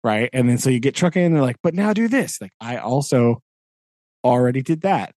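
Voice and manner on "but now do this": much higher mimicking voice; mock eager. Teacher mimicking those who add more tasks, mock eager. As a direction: pile on the task in a mock high voice.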